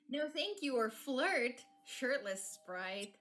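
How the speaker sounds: normal talking voice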